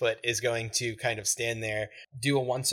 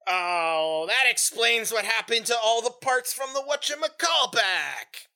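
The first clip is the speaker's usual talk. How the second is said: high, shouted gnome outburst; drawn-out oh, then exclaim it high; indignant gnome piecing it together loudly; outraged realization; 11 semitones higher, 5 dB louder, slower than usual. Acted. Gnome discovers looted remains.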